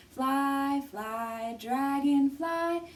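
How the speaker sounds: usual speaking voice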